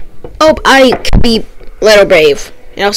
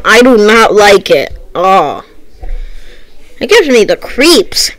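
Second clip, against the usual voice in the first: ordinary delivery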